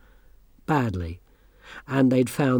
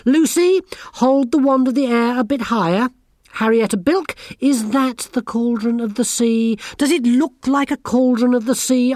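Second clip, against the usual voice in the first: high, loud, impatient commands; bossy girl giving orders; 10 semitones higher, 5 dB louder than usual. A narrator's reading. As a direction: boss the whole circle about. Anagramma orders the girls.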